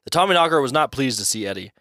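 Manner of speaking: ordinary delivery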